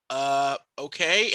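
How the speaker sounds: slow unimpressed okay. Retold or imitated. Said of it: "I was like".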